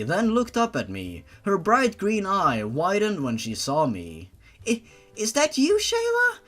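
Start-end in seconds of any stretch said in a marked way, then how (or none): 4.7-6.4 put-on high little-girl voice, hesitant; high childish voice, stammering and unsure; Benna voice. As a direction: play a small frightened child unsure of her sister. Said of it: "Do you like my Benna voice?".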